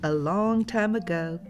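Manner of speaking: ordinary delivery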